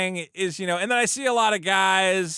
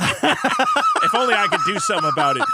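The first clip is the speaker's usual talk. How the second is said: laughing villain; 4 dB louder than usual. Retold or imitated. Mock villain scheming line.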